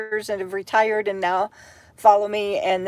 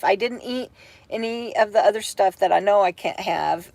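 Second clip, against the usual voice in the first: ordinary delivery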